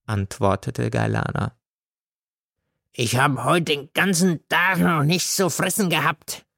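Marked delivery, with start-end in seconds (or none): 2.9-6.5 put-on drunken beggar. A narrator's reading. God begs for food.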